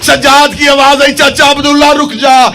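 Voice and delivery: high, loud, impassioned mourning sermon; sustained shouted elegy, drawing out key phrases; full-force lament preaching. Acted. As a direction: cry the tragedy out to the whole gathering.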